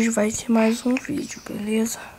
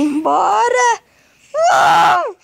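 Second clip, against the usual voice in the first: louder, higher desperate shout; panicked shout; 7 semitones higher, 6 dB louder, slower than usual. Real, not acted. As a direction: yell to get out. Trapped, wants to leave.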